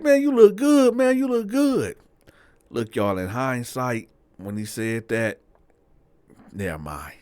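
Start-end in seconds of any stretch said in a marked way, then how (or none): 0.0-2.0 very high, loud admiration; excited compliment. Retold or imitated. Bo admiring his young homie, excited. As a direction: gush it loudly, pitched way up.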